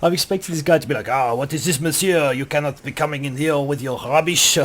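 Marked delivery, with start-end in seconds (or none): 1.1-4.5 hammed-up French accent; put-on French-accented English. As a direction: play a mock Frenchman. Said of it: "I'm running around in France".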